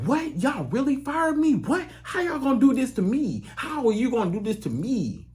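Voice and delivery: high, stunned cry of betrayal; shocked disbelief. Retold or imitated. Fired employee to the job, high shocked disbelief. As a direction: squeak it out, unable to believe it. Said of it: "now you like".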